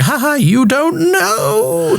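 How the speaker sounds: high, slow creepy sing-song; weird little voice. Retold or imitated. Mocking the actor's villain voice for his friend. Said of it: "he's doing a weird little voice".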